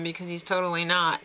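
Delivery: ordinary talk, nothing marked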